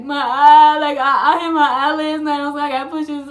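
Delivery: loud, high mock wail; acted-out crying. Crying while trying to tell mom the story, loud wail. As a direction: wail it out, imitating yourself in tears. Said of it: "I'm just crying, trying to tell her".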